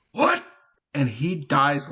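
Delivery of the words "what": put-on high creaky old man "What?"; a sudden rising squeak of an elderly man. Shown as a demonstration. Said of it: "I will give you a preview".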